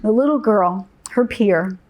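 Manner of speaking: ordinary talk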